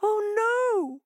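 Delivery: very high drawn-out wail; wailing hare. Acted. Hare frightened by the monster, wailing. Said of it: "wailed Hare".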